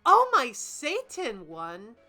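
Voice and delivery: normal talking voice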